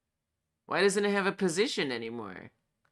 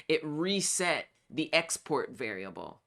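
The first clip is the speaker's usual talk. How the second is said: ordinary talk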